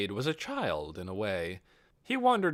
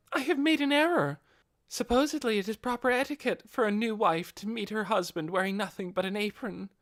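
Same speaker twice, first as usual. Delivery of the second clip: earnest bride, voiced much higher; a devoted wife confessing her slip; high Albedo voice; 11 semitones higher than usual. Albedo confesses her etiquette error to Ainz, earnest and high.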